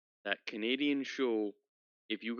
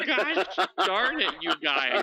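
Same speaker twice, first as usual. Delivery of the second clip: slow, laughing, higher gosh darn it; laughing exasperation; 5 semitones higher, 5 dB louder, slower than usual. Real, not acted. Teased by friends, he laughs out a mock complaint. Flustered after being teased.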